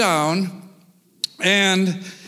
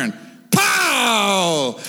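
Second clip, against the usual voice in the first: gunshot noise. Retold or imitated. Gunshot in hunting story.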